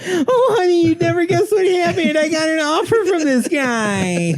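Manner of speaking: very high, gushing falsetto; excited spouse. Acted. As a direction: squeal the good news in falsetto. Painter excitedly tells wife, comedy bit.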